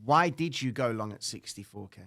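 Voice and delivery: ordinary delivery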